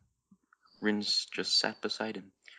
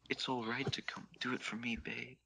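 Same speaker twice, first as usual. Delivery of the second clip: softer, tender coaxing; a tender lover murmuring encouragement; soft reassurance; 4 semitones higher, 7 dB quieter than usual. Acted. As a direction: coax him gently, almost under breath. Rin soothing his lover.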